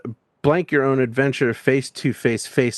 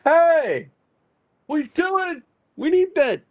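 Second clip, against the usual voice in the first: high squeaky workman voice; construction worker voice; slower than usual. Retold or imitated. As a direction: pitch way up as the worker. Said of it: "a construction worker is like".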